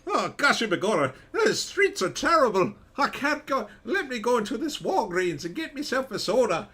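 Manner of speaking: high, loud hammed-up Irish leprechaun voice; squeaky Irish leprechaun, over the top. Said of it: "He'd be like".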